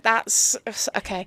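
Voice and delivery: usual speaking voice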